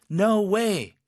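high, bright-eyed amazed exclamation; happily surprised. Shown as a demonstration. Teacher answering practice on a perfect score, happily surprised. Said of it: "이렇게 하셨죠".

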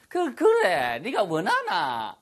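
playful southern Korean intonation, stammered start; hammed-up southern Korean speaker; southern Korean dialect. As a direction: put on a southern Korean drawl, stretched out. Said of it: "좀 남쪽에 계신 분들은".

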